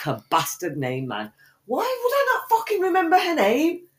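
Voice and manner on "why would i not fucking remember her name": high exasperated whine; exasperated squeal. Real, not acted. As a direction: whine it in frustration. Exasperated at herself.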